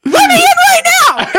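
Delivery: high, loud, hammed-up demand; freaking-out shout. Friend Tom banging on the bathroom door, freaking out. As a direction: act out a frantic man yelling through a door. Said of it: "he's, like, freaking out".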